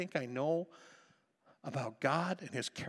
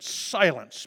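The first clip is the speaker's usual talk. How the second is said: commanding rebuke; 8 dB louder than usual. Acted. Jesus rebuking the storm.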